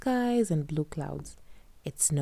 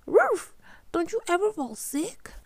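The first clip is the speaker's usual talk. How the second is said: Kadzo's voice; 14 semitones higher than usual. A narrator's reading. Character exclaims.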